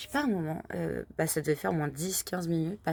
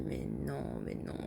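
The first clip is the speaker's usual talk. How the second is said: dismayed murmur; 10 dB quieter than usual. Retold or imitated. Watching a brawl start.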